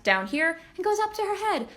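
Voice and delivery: rising pitch illustrating the climb; chest to head. Shown as a demonstration. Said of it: "She starts in her chest".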